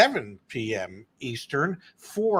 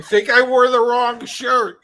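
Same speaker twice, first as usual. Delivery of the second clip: laughing; 8 semitones higher, 9 dB louder than usual. Real, not acted. Green screen makes shirt vanish.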